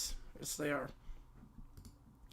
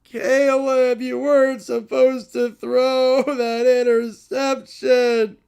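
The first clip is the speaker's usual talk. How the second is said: crying wail; slower than usual. Acted. Bear refuses to stop crying.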